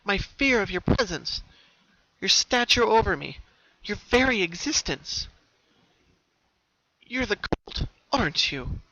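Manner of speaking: put-on high, lively young pony voice; Pinkie voice. Pinkie, resolved, speaking to herself at the door, high and lively.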